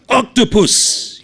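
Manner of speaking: high, loud, drawn-out yell; teasing yell. Acted. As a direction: shout it across the wharf. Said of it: "he yells".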